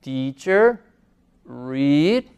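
"Teacher", "read" with a long ee, spaced apart; "Teacher, read" word by word, ea said ee; ea as Spanish i. Shown as a demonstration. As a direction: model "Teacher", "read" as "ticher", "riid". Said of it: "nuestra I en español".

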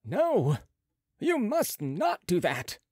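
mildly emphatic protest, slowing; remonstrating. A narrator's reading. Head surgeon protests untying the patient, mild remonstrance. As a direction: object firmly, with deliberate weight. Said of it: "remonstrated the head surgeon".

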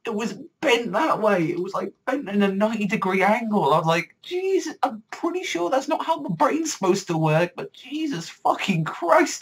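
very high, strained-up exclamation of horror; shocked disbelief. Retold or imitated. Reviewer reliving the sight of a smashed head, shocked disbelief. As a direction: squeal your disbelief, stretching the curses.

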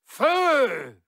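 loud high command; shouted order. Acted. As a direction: bark the order to fire. Villain gives the order.

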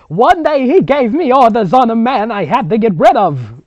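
loud, high hammed-up villain. Grandmother recalling her orders, hammed-up cartoon villain. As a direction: sneer it like a cartoon bad guy. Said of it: "the bad guy from Wreck-It Ralph".

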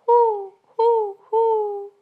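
high, loud hooting owl; high owl hoot imitation. Shown as a demonstration.

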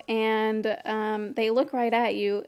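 usual speaking voice

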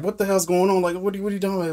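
retold alarmed questioning; mock bewilderment. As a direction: imitate someone thoroughly confused. Retold alarmed reaction to amorous tree.

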